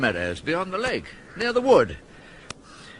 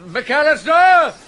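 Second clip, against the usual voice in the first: calling out; 7 semitones higher, 8 dB louder, slower than usual. Acted. Earl calls for gardener.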